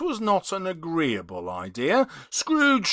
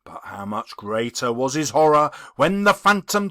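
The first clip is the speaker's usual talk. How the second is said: ordinary delivery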